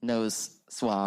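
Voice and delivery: speaking muffled through a face mask; muffled voice behind a mask. Real, not acted. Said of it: "If I sound muffled, it's 'cause I'm masked".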